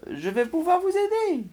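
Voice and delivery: high helpful shopkeeper creature; squeaky anteater voice. Acted. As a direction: pipe it up like a cheerful cartoon critter. Anteater character voiced in story.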